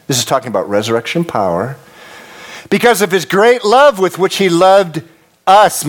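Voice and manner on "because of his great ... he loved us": fervent reading. Acted. Preacher reading with fervour.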